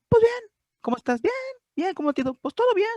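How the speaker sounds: high, bright chirpy small-talk voice; cheery stock reply. Acted. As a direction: chirp the automatic "fine" answer high. Diálogo cotidiano actuado, respuesta automática. (respuesta alegre y aguda de cortesía)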